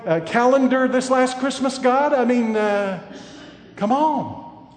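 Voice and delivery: loud, high preacher's cry; building sermon thunder; fiery preaching. Acted. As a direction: press the rebuke home from the pulpit. Preaching at full force.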